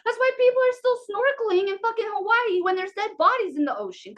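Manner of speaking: ordinary delivery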